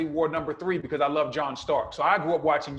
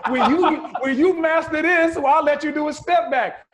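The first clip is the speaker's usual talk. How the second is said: quoting coach while laughing; chuckling as he quotes; laughing through coach quote; 8 semitones higher, 7 dB louder than usual. Retold or imitated. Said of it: "He said".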